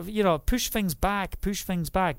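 normal talking voice

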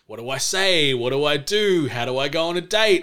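usual speaking voice

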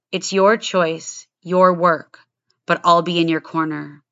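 punchy, low-pitched show opener; scripted podcast intro. Acted. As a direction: deliver a prepared opening monologue with firm, deliberate emphasis.

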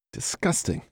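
breathy whispered disgust. Acted. Woman whispering in disgust at the dog licking Jack. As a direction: spit out the word in a revolted whisper.

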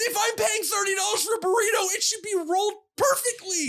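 high-pitched indignant shouting; theatrical customer fury; mock-angry rant. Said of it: "I'm angry".

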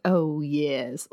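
low mock-seducer voice; [name]. [name] answering Suki's eager question, low mock seduction. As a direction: drop low for the suave lover.

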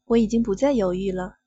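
usual speaking voice

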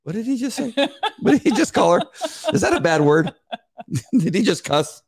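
high, laughing mock-shocked voice; shocked listener. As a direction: play a scandalized churchgoer, laughing. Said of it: "half the people go".

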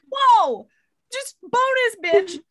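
high, loud, gleeful whoop; mock delight. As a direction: crow over a lucky windfall, hammed up. Retelling the man's delighted windfall.

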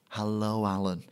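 soft imitation of a Scottish woman; Scottish accent. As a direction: quietly put on a gentle Scottish accent. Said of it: "she had this beautiful Scottish accent".

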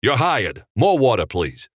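mildly bossy instruction; commanding. A narrator's reading. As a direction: command it, easing off on the last word. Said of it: "he commanded".

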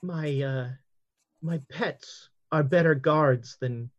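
Madam Lin calmly introducing her gorilla to the frightened guest; calm, even tone. Acted. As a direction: keep it calm and even, no alarm. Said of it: "talking very calmly in a very even tone".

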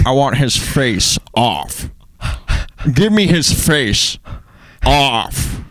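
slow, drawn-out crazy actor; Nick Cage. Acted. Cohost quoting a film line, crazy actor impression. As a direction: stretch each word like an unhinged movie star. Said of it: "Nick Cage is crazy".

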